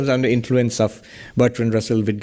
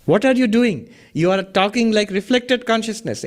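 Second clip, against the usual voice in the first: ordinary talk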